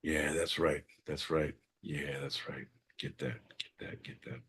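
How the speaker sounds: soft, sinking to whispered touch it; whispering to himself, fading away. Acted. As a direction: drop to a breathy hush. Sinking into a hushed bit.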